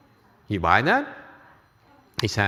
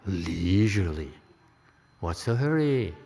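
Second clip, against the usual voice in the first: leisurely; 4 semitones lower, 4 dB quieter than usual. Shown as a demonstration. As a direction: stretch the words as if there is no hurry at all. Acting out unhurried, leisurely calm.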